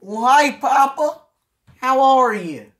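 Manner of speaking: loud, high put-on country kid voice. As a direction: play the farm kid greeting Papa. Kid character greets Papa, loud high.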